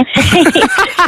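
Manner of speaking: breaks into a bright high laugh; a short real laugh; amused laugh. Real, not acted. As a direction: laugh at your own aside. Laughs mid-question.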